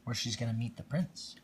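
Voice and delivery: lively read-aloud storytelling voice; storybook reading. A narrator's reading. Parent reading Cinderella to a small child, lively storytelling voice. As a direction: read it like a bedtime picture book.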